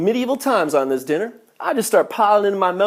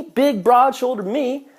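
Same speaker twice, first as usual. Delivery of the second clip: ordinary talk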